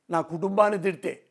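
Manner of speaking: ordinary talk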